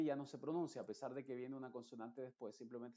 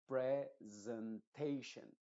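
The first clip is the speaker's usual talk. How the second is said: presentation very slow, each syllable drawn out; slow careful model; much slower than usual. Shown as a demonstration. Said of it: "lo pronuncié mal ... tendría que decirlo". (presentation lento y cuidado, como modelo)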